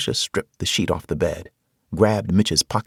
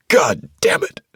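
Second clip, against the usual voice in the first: loud, furious outburst; frustrated curse; 10 semitones higher, 5 dB louder than usual. Acted. Man cursing as he punches a locker, angry. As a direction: explode in frustration. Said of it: "I punched the locker in frustration".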